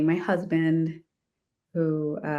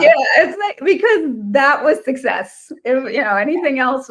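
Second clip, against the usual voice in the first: high, louder, laughing through it; 8 semitones higher, 7 dB louder than usual. Real, not acted. Laughing to the host about what her parents counted as success. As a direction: giggle the words out.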